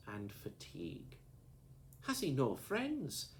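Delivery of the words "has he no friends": tearful voice. A narrator's reading. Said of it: "said she in a tearful voice".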